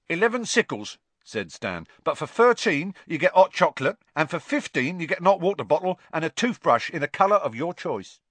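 high, loud salesman patter; cockney lad. A narrator's reading. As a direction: rattle off the prices. Stan voiced in story.

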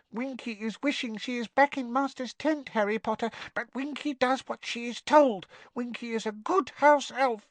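high, wistful yet dutiful squeak; nervous house elf. A narrator's reading. Winky wishes she were in Master's tent, nervous squeak. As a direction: pipe it up like a loyal little elf. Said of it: "another frightened look".

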